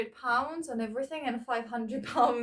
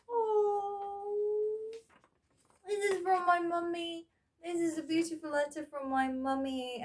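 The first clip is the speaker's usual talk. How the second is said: higher, delighted exclamation at a letter from mum; light up at finding mum's letter; happy surprise at mum's gift; touched delight; 7 semitones higher than usual. Real, not acted. Finds a birthday letter from mum.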